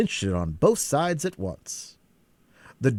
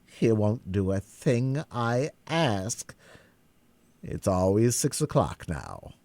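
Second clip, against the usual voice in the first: ordinary delivery, nothing marked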